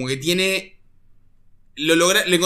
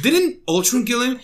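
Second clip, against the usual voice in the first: Monica quoting; 7 semitones higher than usual. Retold or imitated. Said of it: "She goes from this".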